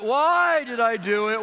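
slow, loud repeated lament; wailing child. Retold or imitated. Son wailing alone in his room, slow and loud. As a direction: wail it again, dragging each word.

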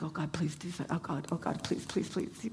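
soft, pleading whispered prayer. Retold or imitated. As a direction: breathe out a desperate plea to God.

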